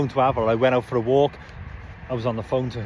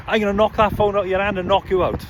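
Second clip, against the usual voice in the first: higher-pitched mock raving; retold ranting threat; 6 semitones higher, 3 dB louder than usual. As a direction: imitate a man ranting threats. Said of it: "ranting and raving".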